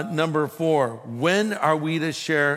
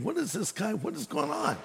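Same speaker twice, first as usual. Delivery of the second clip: much softer, bewildered thinking aloud; baffled aside; 4 semitones higher, 9 dB quieter than usual. Retold or imitated. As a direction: mutter a puzzled thought to yourself. Retold baffled private thought.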